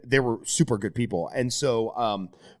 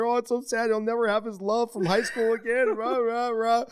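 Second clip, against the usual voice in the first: high, whiny lament; hammed-up blubbering; mock sobbing; 11 semitones higher than usual. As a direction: wail like a heartbroken viewer. Acting out weeping at movies.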